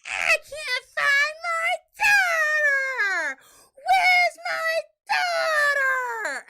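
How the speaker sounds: high drawn-out wailing scream; hollering and screaming. Retold or imitated. Wife imitating husband who thinks his child is kidnapped, hollering. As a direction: scream it in full panic, stretching every word. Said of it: "he's hollering and screaming".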